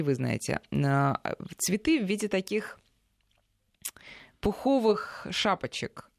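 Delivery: ordinary talk, nothing marked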